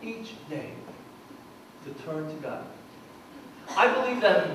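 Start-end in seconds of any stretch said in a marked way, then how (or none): 0.0-2.6 hushed. Acted. Quiet sermon point.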